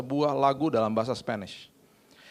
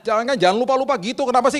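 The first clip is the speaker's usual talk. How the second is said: high, loud nagging; mock-cross, exasperated reproach; scolding housekeeper; 11 semitones higher, 8 dB louder, faster than usual. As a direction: imitate a housekeeper telling off her boss. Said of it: "Saya dimarah-marahin sama dia".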